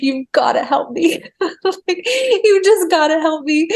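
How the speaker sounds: retold desperate begging, high and amused; laughing plea. Woman begging spirit for help in labour, half-laughing plea. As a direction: beg for help through a laugh.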